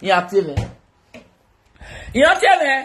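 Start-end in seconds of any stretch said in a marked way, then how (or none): 2.1-2.7 "Yín á sí ewé" again, much higher and louder; loud call. Shown as a demonstration. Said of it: "repeated louder".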